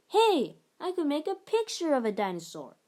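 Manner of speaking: high, loud burst of inspiration; a boy struck by a great idea; excited idea. A narrator's reading. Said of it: "he exclaimed".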